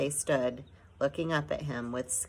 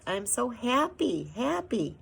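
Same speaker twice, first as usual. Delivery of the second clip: joyful through tears; 8 semitones higher, slower than usual. A narrator's reading. Arrietty crying with joy.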